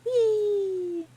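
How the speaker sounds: high, loud gleeful whee; playful whee. Pretend. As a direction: squeal it like a thrill ride. Joking plane blown away.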